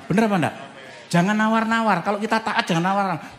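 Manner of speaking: fiery sermon exhortation; preaching. Acted. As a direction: drive the point home to the church.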